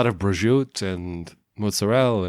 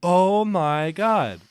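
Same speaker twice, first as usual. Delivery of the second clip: slow, drawn-out amazement; surprised; 8 semitones higher, much slower than usual. Real, not acted. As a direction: gasp it out slowly. Amazed at coincidence.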